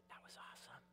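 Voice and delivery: weak, whispered praise; whispered quote. Retold or imitated. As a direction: whisper it like a dying friend. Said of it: "and he'd go".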